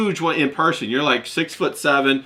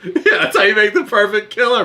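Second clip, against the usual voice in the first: higher voice breaking into laughter while riffing; laughing along; 6 semitones higher, 3 dB louder than usual. Real, not acted. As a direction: agree through real laughter, pitched up. Laughing along at the echoed joke.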